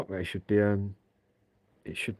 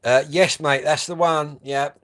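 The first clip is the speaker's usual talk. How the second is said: loud, high radio answer; calling out; 6 semitones higher, 10 dB louder, slower than usual. Real, not acted. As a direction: call it back over the distance. Answering loudly over the radio.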